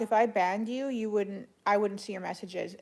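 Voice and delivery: ordinary talk, nothing marked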